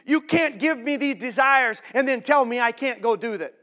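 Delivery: high, loud whine; petulant mock complaint; whiny complainer.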